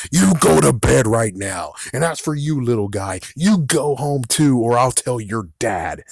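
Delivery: acted-out scolding with punched opening words; stern scolding. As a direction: order the kids home like a fed-up neighbor. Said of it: "now Mister McCall is right there".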